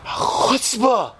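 high, indignant exclamation; mock outrage. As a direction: snap it out like an offended rebbe. Rebbe outraged at the student.

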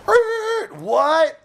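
high startled "er, what"; stunned. Retold or imitated. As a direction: caught short, jump way up in pitch. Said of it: "He's like".

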